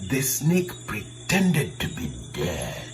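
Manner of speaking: slow, low, hushed narration; soft, suspenseful storytelling. A narrator's reading. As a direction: drop right down and draw it out. Snake plays dead in the story.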